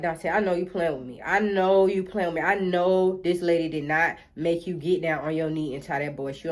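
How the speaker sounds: a hint of a skeptical mama; mama's voice. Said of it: "She like".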